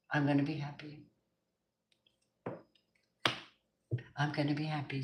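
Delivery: quiet affirmation. Shown as a demonstration. Quiet affirmation before urging it louder.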